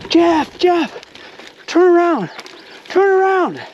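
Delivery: high, urgent call out to a buddy; yelled warning. Retold or imitated. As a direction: holler a warning down the trail. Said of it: "And I yelled out".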